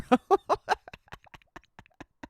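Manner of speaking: squealing laugh up high; high pitched laugh. Real, not acted. Laughing at Elon.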